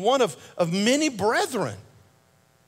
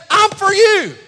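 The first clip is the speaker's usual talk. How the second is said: a loud, slow, high cry of a preacher; booming pulpit proclamation; preaching shout; 11 semitones higher, 10 dB louder, slower than usual. Acted. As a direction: stretch it out and shout it to the back row. God's promise, preached at full force.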